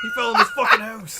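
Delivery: high shouted mock Northern English accent.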